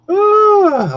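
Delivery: high loud startled cry; sudden yelp. Real, not acted. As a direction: yelp out in surprise. Sudden yelp while walking.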